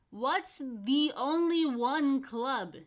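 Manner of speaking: talking through a mouthful of peanut butter; mouth full. A narrator's reading. Boy asks Jennifer about her badge through a mouthful. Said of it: "with a mouth full of peanut butter".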